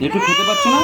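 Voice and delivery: high mock-baby squeak; squeaky baby voice. Teasing the baby daughter in a squeaky voice. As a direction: tease her in a tiny high voice.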